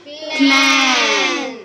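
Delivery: "क्लैन" drawn out slowly and loud; stretched loud word. Shown as a demonstration. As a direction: sound out "क्लैन" for the class. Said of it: "तो क्या बनेगा?".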